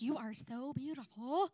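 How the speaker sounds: slow, lightly voiced flamboyant man; a hint of a gushing admirer; flamboyant compliment. Stranger in a tutu gushes over her, lightly mimicked slow. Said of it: "he's talking like this".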